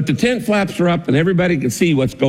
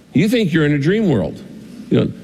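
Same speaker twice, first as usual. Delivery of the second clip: normal talking voice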